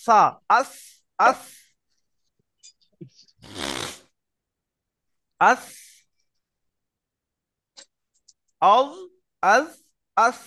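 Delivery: আস with the tongue tip lightly on the teeth; touch the teeth gently, আস; delicate articulation. Shown as a demonstration. Teacher drilling finely articulated letters. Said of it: "খুব সূক্ষ্মভাবে হয়".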